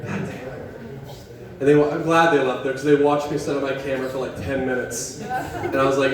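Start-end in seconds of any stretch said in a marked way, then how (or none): none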